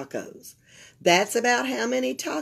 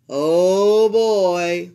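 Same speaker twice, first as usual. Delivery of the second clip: put-on loud oh boy; 7 dB louder than usual. A narrator's reading.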